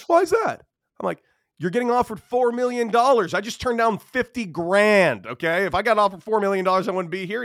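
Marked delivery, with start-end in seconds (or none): 0.1-0.6 very high, innocent question; Gerrit Cole. Retold or imitated. Imitating the young pitcher questioning him, high-pitched. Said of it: "interesting high-pitched voice". 1.6-7.4 raised, louder, incredulous telling-off; exasperated retort. Retold or imitated. Retelling his retort to the cocky freshman, exasperated. Retold irritated retort to Cole.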